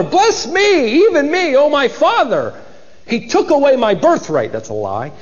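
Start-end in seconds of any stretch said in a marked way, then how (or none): 0.0-2.5 high-pitched, desperate plea; acted scream. Son begging his father for blessing, high mock scream. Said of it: "He began to scream, literally". 3.1-4.5 high, acted bitter protest; bitter cry. Son accusing his absent brother, bitter cry. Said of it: "exceedingly great and a bitter cry".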